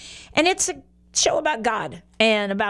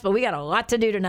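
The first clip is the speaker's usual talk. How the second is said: rushed, rapid delivery; talking fast; 2 semitones lower, much faster than usual. Real, not acted. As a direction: hurry through it like there's lots to cover. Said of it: "I know I'm talking fast".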